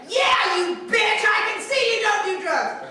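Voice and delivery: high, loud and slow sneer; mock her with a sneer; sneering cellmate. Cellmate mocking the narrator's claim, sneering. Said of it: "she grabbed my arm".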